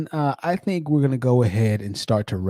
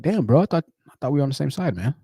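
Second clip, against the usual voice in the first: mildly hurt; hurt bro; 4 semitones higher than usual. Retold or imitated. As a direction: sound let down by a friend. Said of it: "It's like".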